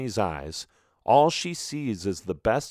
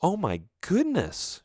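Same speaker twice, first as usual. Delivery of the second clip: disgusted gasp; 9 semitones higher than usual. A narrator's reading. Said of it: "she is disgusted by the damage".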